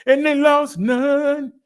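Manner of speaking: high, loud and slow, crowing it; triumphant catchphrase. Acted. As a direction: sing out the good news like a victory call. Catchphrase on zero deaths.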